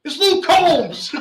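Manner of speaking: imitating a stranger's high, loud, slow yell of recognition; mock fan shouting in surprise; excited shout. Stranger in the parking lot spots him, high excited shout. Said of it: "some guy goes".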